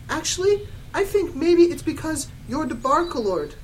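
high, loud retort; angry turn. Acted. Girl turning to correct Satan, mock angry. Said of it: "I turned around angrily".

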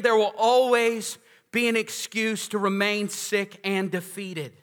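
slow, deliberate preacher emphasis; slow emphatic restatement. Shown as a demonstration. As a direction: hammer it home slowly. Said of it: "can I say it again?".

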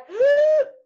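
loud, high, drawn-out gasp; exaggerated intake of horror; mock shocked gasp. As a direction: gasp like a scandalised mum. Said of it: "your mum will be like".

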